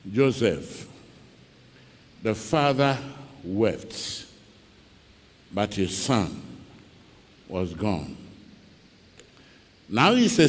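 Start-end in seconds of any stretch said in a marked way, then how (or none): 0.1-8.2 soft, low and grieving; sorrowful. Acted. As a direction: let the grief sink the voice. Sorrowful telling of loss.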